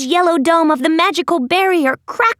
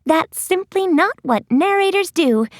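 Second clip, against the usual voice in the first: usual speaking voice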